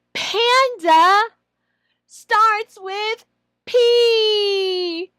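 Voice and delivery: high, very slow and loud; teacher voice. Shown as a demonstration. Trainer shows the phonics sentence, slow and loud. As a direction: spell it out slowly for a young learner. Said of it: "and show that".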